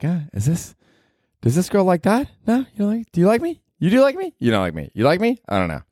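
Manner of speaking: high, fluttering self-doubt; mock anxious fretting. Wondering if a girl likes him, high fretting questions. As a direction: swing up high with each nervous question.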